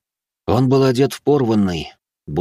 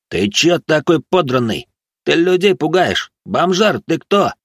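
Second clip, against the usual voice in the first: higher, rowdy challenge; jeering drunk youth; 7 semitones higher, 4 dB louder than usual. Acted. Drunk lads harass the ragged man, jeering. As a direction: heckle him in a raised voice.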